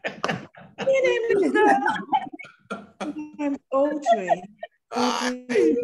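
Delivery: high, laughing small voice; shy little English girl. Retold or imitated. New girl answering the kids, shy and amused. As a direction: play your timid younger self. Voicing her shy child self.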